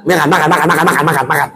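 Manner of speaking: rapid-fire repeated words, no gaps; hurried feeding. Acted. Mother feeding her child, hurried. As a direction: rattle it off as if cramming food in. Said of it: "Kalau ibu nyiapin anak".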